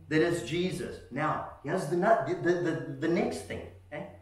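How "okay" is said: normal talking voice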